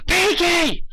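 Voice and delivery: high, loud call across the field. Acted. Dash calling Pinkie to start the prank, high and loud. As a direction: yell her name to get her attention.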